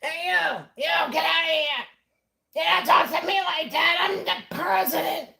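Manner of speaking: high-pitched, loud mocking shout; indignant hammed-up yelling; upset Donald Trump. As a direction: shout in mock outrage, pitched well above your own voice. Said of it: "he was getting very upset".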